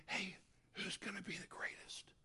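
hushed conspiratorial whisper; whispered aside. Acted. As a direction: lean in and whisper it behind his back. Disciples scheming at the supper.

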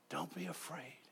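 very soft, hushed and tender; Samuel's comfort. Retold or imitated. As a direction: comfort a terrified crowd almost in a murmur. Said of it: "Samuel said to the people".

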